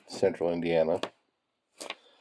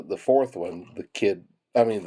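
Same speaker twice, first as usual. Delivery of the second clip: ordinary delivery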